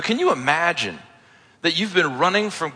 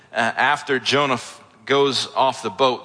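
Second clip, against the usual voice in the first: ordinary talk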